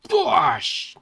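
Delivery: very high, loud smacking effect; explosive vocal impact; imitated kick impact. A narrator's reading. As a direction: burst out the blow as a noise.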